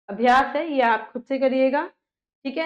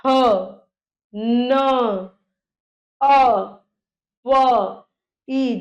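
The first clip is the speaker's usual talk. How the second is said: each sound drawn out alone; teacher's slow phonetic breakdown; sounding out slowly; 3 dB louder, much slower than usual. Shown as a demonstration. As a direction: pause long between the sounds. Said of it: "आ की ध्वनि आ रही है".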